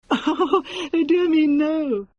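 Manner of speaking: high, trembling laugh through the words; shivering little laugh. Acted. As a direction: laugh thinly while speaking. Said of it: "with a shivering little laugh".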